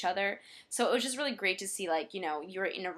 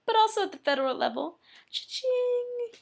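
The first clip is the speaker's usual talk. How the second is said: excited; 4 dB louder than usual. Real, not acted. Excited about legal name change.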